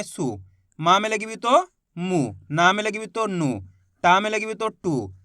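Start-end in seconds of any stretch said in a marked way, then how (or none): 0.0-0.4 सु said short and low, vowel barely stretched; short u sound. Shown as a demonstration. Teacher to students, a short low सु. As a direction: keep the u in सु brief. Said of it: "इतना ही खींच के पढ़ेंगे".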